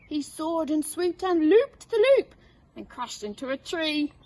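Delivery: storyteller relishing the crash; lively narration. A narrator's reading. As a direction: build the excitement to the crash.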